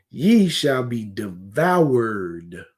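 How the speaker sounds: very slow, drawn-out scripture line; slow solemn quote. Real, not acted. Preacher warning the congregation, very slow and drawn out. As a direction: stretch every word like a warning.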